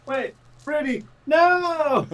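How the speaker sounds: rising panicked call; alarmed cry. Acted. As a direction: shout a warning in alarm. Alarmed calling out.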